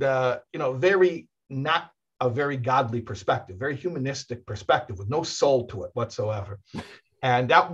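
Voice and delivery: ordinary delivery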